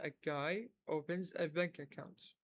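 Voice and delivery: very slow and clear; slow repeat. Shown as a demonstration. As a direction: repeat it slowly for practice. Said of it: "نعيده مرة ثانية". (إعادة بطيئة وواضحة)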